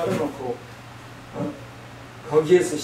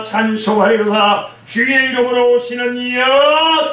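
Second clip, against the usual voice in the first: 찬송하리로다 and 이여 proclaimed loud and higher, drawn out slowly; proclaimed praise; 7 semitones higher, 6 dB louder than usual. Acted. Preacher reading the crowd's praise to his congregation, loud slow proclamation. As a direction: ring out 주의 이름으로 오시는 이여 like a herald.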